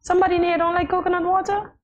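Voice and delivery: high-pitched disbelief; scandalized, high-voiced question; incredulous. Real, not acted. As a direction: ask it astonished, pitch held high. Disbelief at dislike of coconut water.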